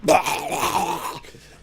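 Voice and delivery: harsh imitated monster roar; loud, high monster noise cutting off a song; loud zombie roar.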